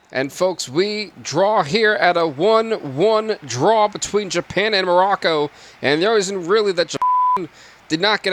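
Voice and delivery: sports announcer. Acted. Closing the match for the audience, announcer voice. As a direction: sum up the draw like a broadcast host.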